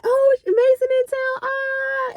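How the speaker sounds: high excited squeal; excited relatives. Retold or imitated. Family spotting a visiting cousin, high excited squeal. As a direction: relatives hyped to see a cousin. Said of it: "they was like".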